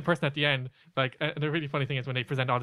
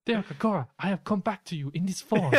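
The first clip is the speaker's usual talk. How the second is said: high, solemnly announcing spirit guide; comic accented spirit voice; Sam; 5 semitones higher than usual. Acted. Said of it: "You should do a Sam impression".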